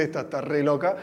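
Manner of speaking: soft mocking aside; inner thought. Calm girl laughing at the teacher, soft aside. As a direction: say it under your breath. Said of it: "se ríe y dice".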